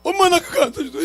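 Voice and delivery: put-on high, loud teary boy's plea; crying child. Lost boy searching the store for his mom, high teary plea. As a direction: beg like a lost kid. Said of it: "막 웅웅 울어".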